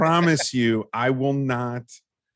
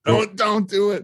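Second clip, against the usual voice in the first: higher-pitched mock pleading warning; voice in the head; 8 semitones higher than usual. Host quoting their inner voice against trying an Australian accent, high mock warning. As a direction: beg yourself not to, voice pitched up. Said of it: "the voice in my head just said".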